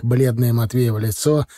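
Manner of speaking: ordinary delivery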